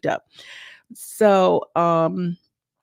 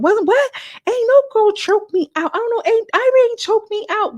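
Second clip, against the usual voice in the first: way up high and louder, a squealing denial; shrill mock denial; 5 dB louder than usual. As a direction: deny it at the very top of the voice.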